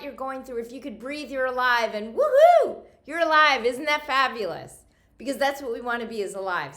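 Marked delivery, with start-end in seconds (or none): 1.9-2.8 loud, high "woohoo" cheer; celebratory whoop. Real, not acted. Celebrates being alive out loud. 3.0-4.8 loud, high, celebratory exclamation; jubilant. Real, not acted. Celebrates being alive.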